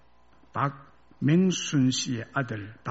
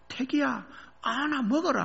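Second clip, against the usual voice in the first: mother calling; 10 semitones higher than usual. Retold or imitated. Mother handing melons to son at sports day, high light call. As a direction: toss it off like a busy mother.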